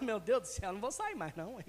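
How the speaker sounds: put-on a kid scared into promising; lightly voiced child's frightened vow. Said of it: "Você fala".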